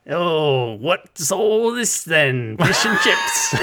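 hammed-up Cockney accent, slow then a high squeal; mock Cockney. Mocking an actor's bad Cockney accent to a friend. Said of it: "his British is supposed to be like a Cockney".

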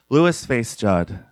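ordinary delivery

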